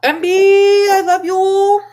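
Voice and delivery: high loud put-on voice; friend impression. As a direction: squeal it out affectionately. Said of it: "That's exactly how he sounds".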